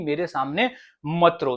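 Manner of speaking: ordinary talk, nothing marked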